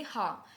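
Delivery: German H named हा, not "aitch"; German letter H. Shown as a demonstration. Teacher showing how to pronounce H, हा as "hah". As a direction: say हा as breath-started "hah". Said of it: "कैसे pronounce करेंगे?".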